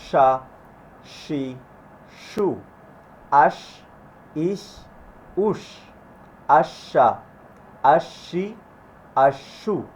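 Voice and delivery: airy, thin SH in "আশা, আশি, আশু"; full-mouthed sh. Shown as a demonstration. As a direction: fill the mouth with air for the SH of "আশু". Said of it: "মুখ ভরে বাতাসটা বেরোবে". ("শ"-তে মুখ ভরে বাতাস, আওয়াজ পাতলা)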